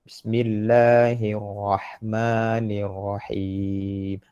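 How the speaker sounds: very slow, level Quran recitation; teacher's drawn-out model verse. Shown as a demonstration. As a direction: recite it syllable by syllable, vowels held.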